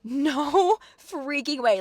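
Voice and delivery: long, high, slow emphatic refusal; real indignation. Real, not acted. Vlogger with blood boiling again at the memory, indignant. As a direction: stretch each word out in heated disbelief. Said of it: "my blood is boiling again".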